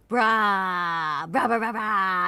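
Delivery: low, drawn-out "brah" growls; playful scare noises in a put-on voice; mock monster noise. Streamer pretending to scare chat, low mock roar.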